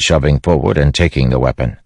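ordinary delivery, nothing marked

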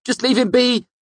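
high, loud panicked plea; shrill demand to back off. A narrator's reading. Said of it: "Bast's voice was shrill".

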